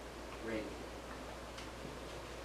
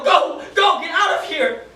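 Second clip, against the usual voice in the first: much higher and louder repeated yells; 12 semitones higher, 12 dB louder than usual. Retold or imitated. Soldier yelling prisoner away from gunfire, desperate yell. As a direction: scream at him to run before the gunfire. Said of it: "And I yell".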